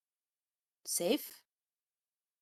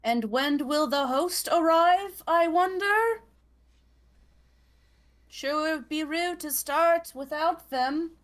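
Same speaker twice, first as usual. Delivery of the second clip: sarcastic loud; 9 semitones higher, 10 dB louder than usual. Acted. Player loudly addressing empty room.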